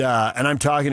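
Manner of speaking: ordinary talk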